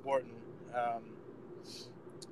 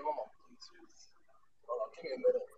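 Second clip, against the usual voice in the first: bad connection; 10 semitones higher, 9 dB quieter, faster than usual. Real, not acted. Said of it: "You're cutting out a little bit on us".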